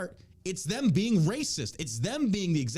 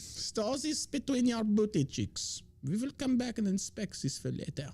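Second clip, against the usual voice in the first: slow, higher camp German officer; mock German accent; 6 semitones higher, slower than usual. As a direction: give orders slowly in a cartoon German accent. Repeats line as German officer.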